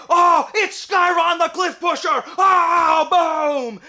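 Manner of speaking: high, loud melodramatic announcement; over-the-top climactic shouting; shocked reveal shout. Acted. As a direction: yell the villain's big reveal. Villain unmasked in climax.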